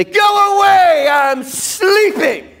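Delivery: high, loud, slow groggy shout; grumpy sleeper. Retold or imitated. Preacher voicing the sleepy friend, grumpy shout. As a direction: bark it like a woken neighbor told to leave. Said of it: "The father doesn't say".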